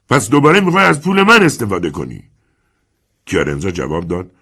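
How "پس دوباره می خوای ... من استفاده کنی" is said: high, loud bitter accusation; angry retort. Acted. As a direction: spit back the charge. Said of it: "اسکالا جواب داد".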